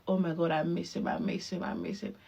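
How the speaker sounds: fading to a breathy whisper, missing her son; longing whisper. Retold or imitated. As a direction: sigh it out, dropping into a whisper. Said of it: "the other minute you are like".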